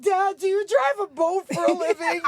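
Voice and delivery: high and loud, innocent; naive teen question. Retold or imitated. Teen asking her father about his job, naive. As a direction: ask it wide-eyed and earnest. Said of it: "I was like".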